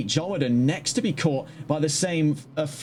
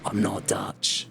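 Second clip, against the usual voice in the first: dry aside; 8 semitones lower, slower than usual. Real, not acted. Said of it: "from the accent".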